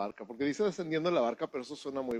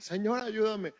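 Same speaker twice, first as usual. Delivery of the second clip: cry for help; 8 semitones higher than usual. Retold or imitated. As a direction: call out as you sink. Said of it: "pega de gritos".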